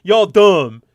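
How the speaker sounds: loud, laughing put-down in mimicry; mocking condescension. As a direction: crow it like a gloating snob. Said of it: "intentionally pointed to be like".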